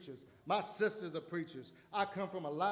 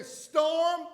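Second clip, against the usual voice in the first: commanding the storm; 8 semitones higher, 6 dB louder, much slower than usual. Acted. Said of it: "I'm gonna say".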